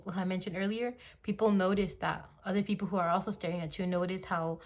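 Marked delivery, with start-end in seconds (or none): none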